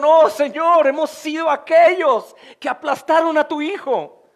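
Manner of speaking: high, loud pleading cry to the Lord; crying out to God. Retold or imitated. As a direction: cry out to God as the repentant crowd. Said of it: "y clamaron".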